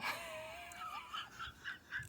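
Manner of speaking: a high shrieking burst of laughter. Real, not acted. Laughing in shock at misreading a viewer's name.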